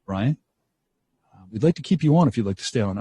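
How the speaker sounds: unruffled, measured and low; very calm. Retold or imitated. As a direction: imitate a boss answering an outburst very calmly. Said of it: "looks at me very calmly".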